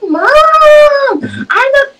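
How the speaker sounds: put-on high, slow little-kid voice; mock childlike whine. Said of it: "she turned into, like, a kid".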